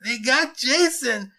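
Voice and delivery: high, loud, drawn-out wail; acted blubbering boy. A narrator's reading. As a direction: blurt it out in distress. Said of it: "he blubbered out".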